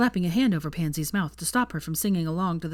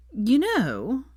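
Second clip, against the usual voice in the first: put-on drunken slur; 7 semitones higher than usual. A narrator's reading. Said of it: "Pansy slurred".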